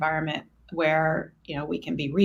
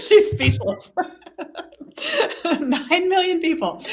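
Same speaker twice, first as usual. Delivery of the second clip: laughing; 13 semitones higher, 6 dB louder than usual. Real, not acted. Laughing at the joke.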